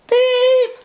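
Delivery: high, loud beep; whistle. A narrator's reading. Guard whistling for departure, high and loud. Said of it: "whistled the guard".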